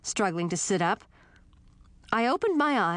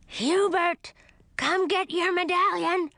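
skull shouting; 11 semitones higher than usual. Acted. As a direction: call out high across the room. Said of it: "shouted one of the skulls".

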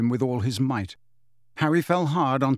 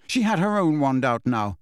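ordinary delivery

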